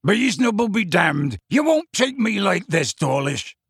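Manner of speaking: high, loud, furious giant; Hagrid roaring. A narrator's reading. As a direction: roar back in defiance. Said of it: "and Hagrid roared".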